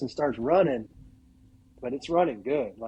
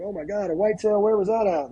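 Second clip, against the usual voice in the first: playing the thrilled neighbours; imitating excited locals; 7 semitones higher than usual. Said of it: "all the locals are super excited".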